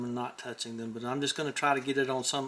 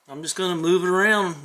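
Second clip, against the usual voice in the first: normal talking voice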